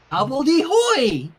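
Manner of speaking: put-on loud, slow baby shout; toddler voice again. Flurry repeating the word at Rainbow, loud and slow.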